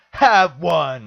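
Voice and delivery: loud mock-furious retort; angry shout. Quoting a voter refusing a sticker, angry shout. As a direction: imitate a man yelling on his way out. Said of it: "storms out of the freaking building".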